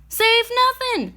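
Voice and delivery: high, sharp cry of disagreement. A narrator's reading. Old woman calling to the skunk, high scolding cry. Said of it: "cried Euphonia".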